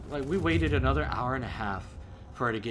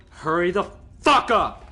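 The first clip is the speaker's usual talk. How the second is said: louder, slow, punched-out demand; retold angry yell; 3 semitones higher, 8 dB louder than usual. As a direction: act out losing patience on the phone. Retells yelling at late friend.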